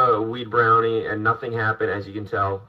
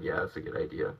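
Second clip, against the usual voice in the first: sleepy whisper; 6 semitones lower, 10 dB quieter than usual. Retold or imitated. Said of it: "I was like".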